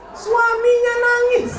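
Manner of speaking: high, loud comic cry; a playful shouted punchline; mock wail. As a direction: ham up the husband's crying. Comic twist in the story.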